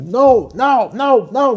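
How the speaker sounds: high, loud mocking no no no; hammed-up high protesting shout; mock shrill shout. Doctor imitates the nurse shouting no at him, mock shrill. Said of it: "she was getting emotional".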